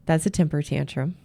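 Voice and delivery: usual speaking voice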